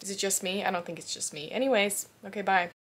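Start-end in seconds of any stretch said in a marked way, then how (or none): none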